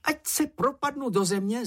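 very high-pitched, excitable babble; jabbered oath. A narrator's reading. Čenich swears to keep the secret, high jabbering voice. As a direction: gabble a solemn oath in a small high voice. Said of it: "zabrebentil Čenich".

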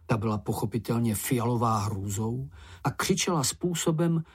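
plain reading voice

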